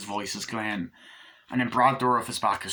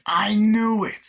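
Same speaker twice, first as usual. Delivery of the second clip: much higher, louder cry of vindication; triumphant shout; 10 semitones higher, 7 dB louder, slower than usual. Retold or imitated. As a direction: yell it like you finally caught them. Character's triumphant realisation.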